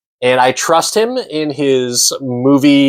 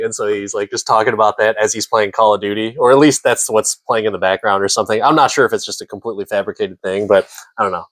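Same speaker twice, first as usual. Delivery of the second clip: ordinary delivery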